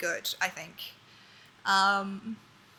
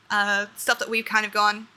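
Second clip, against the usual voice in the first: normal talking voice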